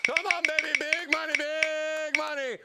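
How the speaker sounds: loud, high "big money" chant, stretched at the end; root for the wheel like a gambler; wheel hype cheer. Real, not acted.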